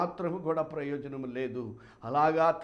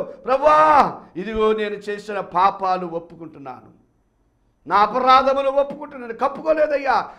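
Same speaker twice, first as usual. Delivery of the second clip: higher, louder repentant cry; loud confession; 5 semitones higher, 10 dB louder than usual. Acted. Son confessing before God, loud preached cry. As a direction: confess every sin at full voice.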